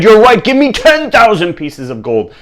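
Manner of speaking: high, loud, eager demand; greedy excitement. Acted. King raising his wish to the lamp, greedy. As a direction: blurt out the bigger wish loudly.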